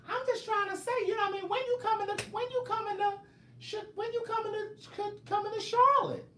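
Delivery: very high squeaky complaining voice; mocking a fan's voice. Mocking Bianca rushing home for the live, squeaky high whine. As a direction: whine like an impatient fan. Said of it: "that's how you talk too".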